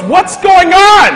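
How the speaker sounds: high, loud, slow panicked yell; drawn-out alarmed shout. Retold or imitated. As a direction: businessman yelling in panic as he is grabbed. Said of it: "And he yells".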